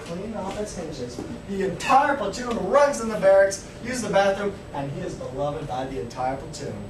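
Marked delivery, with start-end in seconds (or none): none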